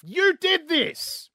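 drawn-out, high-pitched mock accusation; accusing outburst. Joke's owner accusing his parrot, indignant accusation. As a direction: stretch each word in high, outraged accusation.

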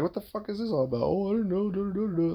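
high-pitched singsong blah-blah mimicry; mocking imitation. Husband mimicking the texted argument with his wife, mocking.

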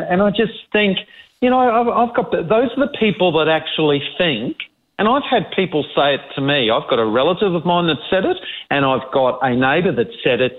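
normal talking voice

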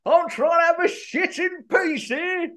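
high-pitched annoyed complaint; grumpy interrupted. Acted. Host mocked for always moaning, high annoyed voice. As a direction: act put out at being disturbed.

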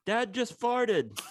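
put-on child's call. Toddler in the cart calling to mom in a high child voice. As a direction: call across the store like a small boy.

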